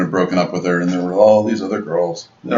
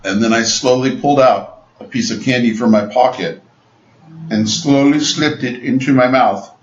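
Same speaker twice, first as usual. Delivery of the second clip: ordinary talk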